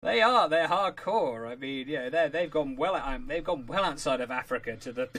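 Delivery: normal talking voice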